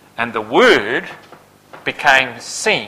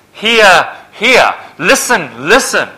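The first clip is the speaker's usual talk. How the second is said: hear and listen repeated, rising louder; emphatic repeat; 5 semitones higher, 5 dB louder, slower than usual. Shown as a demonstration. Preacher urging the congregation, emphatic. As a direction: hammer the words hear and listen. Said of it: "it's very emphatic".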